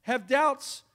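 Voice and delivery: high and loud, hammering every phrase; full-force preaching. Acted. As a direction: shout it out in disbelief at John. Preacher peaks into full-force exhortation.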